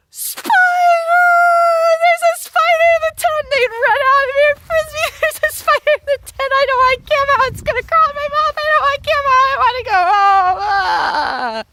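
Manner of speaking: put-on high, fast panicked kid screams; screaming at a spider. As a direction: shriek like a terrified little girl. Campers panicking over a spider.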